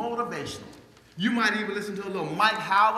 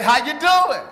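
cheery greeting; 6 semitones higher, 8 dB louder, slower than usual. Retold or imitated. Cheerful greeting to the lunch lady.

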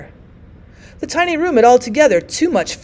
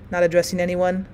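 plain reading voice

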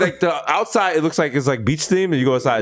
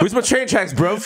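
higher-pitched London roadman parody; mock London accent; 5 semitones higher than usual. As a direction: demand it in put-on London street slang. Spoofing British Top Boy John Henry.

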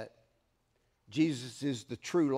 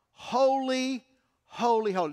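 high called-out holy; cry of holy; 7 semitones higher, 4 dB louder than usual. Acted. Preacher voicing the heavenly cry of holy. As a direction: lift each holy like a cry. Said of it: "is the cry".

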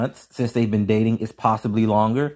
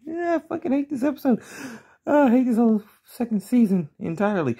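high, exasperated whine; exasperated groan; 11 semitones higher than usual. Real, not acted. Reviewer on a hated episode and season, exasperated. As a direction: complain in a higher, fed-up voice.

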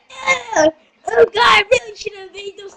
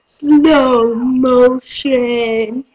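very slow, low drawl; mock slow motion; 4 semitones lower, much slower than usual. Announcing slow motion in a fight, drawn out low and slow. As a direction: drag the words out low like slowed film.

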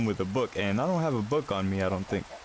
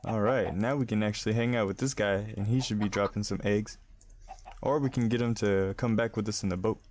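ordinary talk

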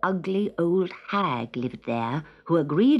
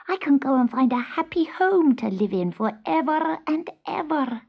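hopeful kitten; 7 semitones higher than usual. A narrator's reading. Kitten hopes for a happy home.

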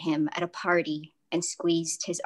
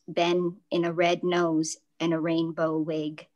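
ordinary talk, nothing marked

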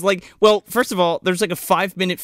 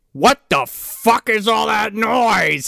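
slow, higher mock-gruff dad demanding; angry dad; 7 semitones higher, 4 dB louder, slower than usual. Imitating the dad shouting at his daughter about the noise, slow mock anger. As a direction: drag it out like a fed-up father woken up. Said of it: "and he's like".

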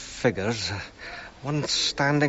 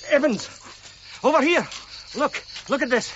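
calling out; 11 semitones higher than usual. Real, not acted. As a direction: shout over to Evans, excited. Calling out across dig.

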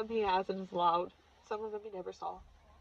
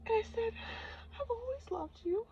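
much higher, tearful-sounding; choked up; 11 semitones higher than usual. Real, not acted. Daughter recounting hug from her late mother, high and choked up. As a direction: voice rising with emotion. Emotional vision of hugging mother.

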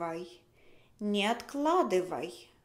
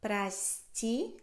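slow model; slower than usual. Shown as a demonstration. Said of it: "Прости меня".

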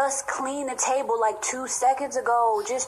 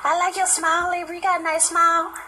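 higher, sweet fawning compliments; gushing flattery; 6 semitones higher than usual. Acted. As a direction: fawn over her, sugary. Flattering Libra at party.

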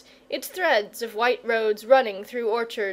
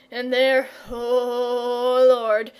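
louder, drawn-out cry of dread; nervous terror; 4 dB louder, much slower than usual. A narrator's reading. Shepherd recounts what he saw in the cave, nervous terror. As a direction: recall a frightening sight with mounting alarm. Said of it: "her husband's nervous terror".

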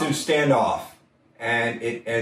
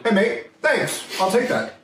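mock Irish accent; 10 semitones higher than usual. Prisoner thanking his fellow inmate, in a mock Irish accent. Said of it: "the other one said".

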